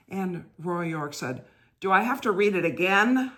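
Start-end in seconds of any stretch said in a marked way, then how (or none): 1.8-3.3 higher, much louder exasperated question; exasperated loud reply. Retold or imitated. Reader blurting out in exasperation at the rector, louder. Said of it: "Roy York gets louder and louder".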